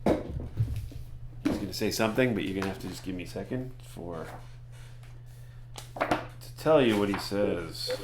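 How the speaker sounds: ordinary delivery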